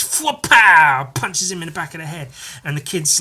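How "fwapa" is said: loud comic-book impact noise; punch sound effect. Retold or imitated. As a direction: burst it out like a landed punch. Imitating a punch.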